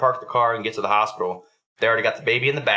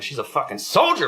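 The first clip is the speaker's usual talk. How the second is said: higher, punchy retold boast; proud hype; 5 semitones higher than usual. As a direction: brag about your tough kid, leaning on the key word. Proud dad hyping up his baby.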